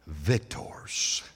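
whisper. Acted. Dropped to a whisper on the climax.

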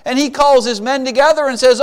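usual speaking voice

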